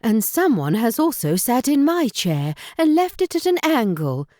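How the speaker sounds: storybook mother bear, mid-range and fond; mother bear complaining, lightly voiced; Mother Bear's middle voice. A narrator's reading. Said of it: "said Mother Bear in her middle voice".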